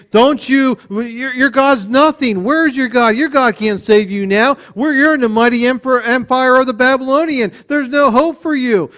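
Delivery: hammed-up conqueror's taunt. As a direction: jeer at a beaten captive. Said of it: "The Babylonians are taunting this guy".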